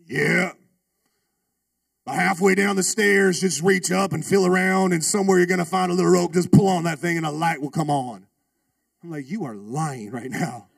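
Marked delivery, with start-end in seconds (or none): 0.1-8.3 louder gruff biker instructions. Retold or imitated. Biker explaining the light switch, loud and gruff. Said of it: "He talked like that too". 9.3-10.7 low, slow, flat accusation; deadpan disbelief. Retold or imitated. Student to the biker, low slow disbelief.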